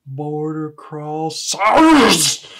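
"Border" slow and careful, CROSSERS erupting with a huge R; "Border crossers" very drawn out, ending in an explosive hard R. Acted. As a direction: try to hold back, then blast the R in "crossers". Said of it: "That's a pretty hard R you use there".

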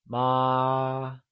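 "ma" held long and level; high level tone. Shown as a demonstration. Teacher gives the correct answer, "ma" in high level tone. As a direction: sustain "ma" on a single pitch. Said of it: "constant high-level tone".

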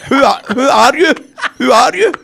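incredulous friend. Retold or imitated. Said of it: "He's going".

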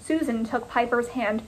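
usual speaking voice

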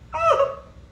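short, very high put-on whoop; gleeful devil sound. Preacher acting Satan arriving when ugliness is spoken, high gleeful squeal.

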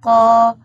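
"قا" loud and open, qaa; "قا" modelled as a strong, isolated qaa; qaf with fatha. Shown as a demonstration. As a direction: sound out "قا" loudly as one long syllable. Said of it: "قاف فتحة".

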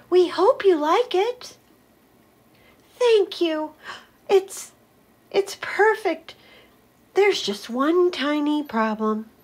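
high grateful character; high happy voices. Acted. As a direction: gush with thanks.

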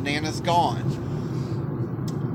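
very slow, hushed words of loss; grief-stricken. Retold or imitated. Telling mother of the death, slow stunned grief. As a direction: slowly say the words you can barely get out. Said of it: "The hardest words I've ever had to say".

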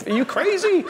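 mock incredulous. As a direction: scoff as if the idea is insane. People scoff at the shepherd.

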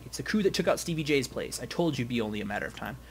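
ordinary talk

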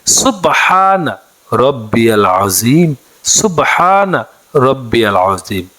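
slow, drawn-out recitation of সুবহানা রব্বিয়াল আযীম; model recitation. Shown as a demonstration. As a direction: chant it slowly so students can follow. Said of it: "উচ্চারণটি বাংলাতে এইভাবে".

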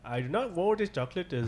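put-on Indian accent. Friend obliging a request, mock Indian accent. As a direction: play it as an Indian accent bit. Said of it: "can you do that Indian accent".

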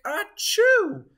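big high loud sneeze. Acted. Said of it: "The snowman sneezed a gigantic".

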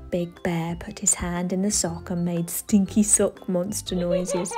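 ordinary delivery

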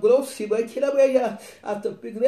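loud, high burst of tongues; speaking in tongues. Retold or imitated. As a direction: re-enact a fervent outpouring in tongues. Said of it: "I start speaking another tongue".

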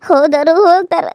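normal talking voice

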